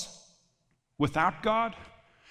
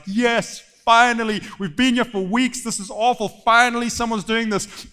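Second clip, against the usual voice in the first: loud, higher, overjoyed king; giddy relief; 5 semitones higher, 8 dB louder than usual. Acted. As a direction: burst out as giddy Saul. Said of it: "giddy because some warrior".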